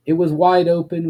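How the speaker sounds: usual speaking voice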